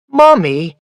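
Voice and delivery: put-on a delighted little boy waking his mother; loud and happy. A narrator's reading. Small boy calling his mother at dawn, loud and happy. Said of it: "I said loudly and happily".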